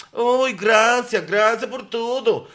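imitating a Brazilian accent, high and slow; mock Julia's Brazilian Spanish, drawn out and sweet; acento brasileño. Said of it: "con su acento de brasilera". (imitando con acento brasileño, agudo y lento)

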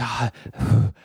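imitated exasperated sigh; impatient sigh. Imitating counter lady waiting on a slow customer, impatient sigh. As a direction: let out a low, weary huff.

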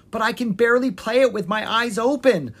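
loud, high-pitched protest; complaining. A narrator's reading. Prince doubts he can play the lullaby, complaining loudly. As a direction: complain in a panic. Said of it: "Lucas complained".

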